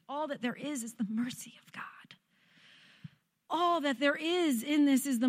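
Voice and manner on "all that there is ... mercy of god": awed whisper. Real, not acted. Awed aside.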